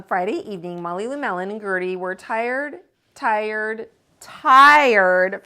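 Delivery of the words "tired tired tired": very slow and louder, drawn out; slow tired. A narrator's reading. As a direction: drag it out like you're worn out.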